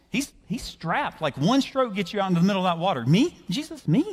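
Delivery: high-pitched comic protest; incredulous deflecting. Acted. Simon pointing Jesus to James's boat, incredulous. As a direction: talk up the other man, baffled at being picked.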